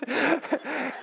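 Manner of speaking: very high giggle in the wife voice; high laugh. Retold or imitated.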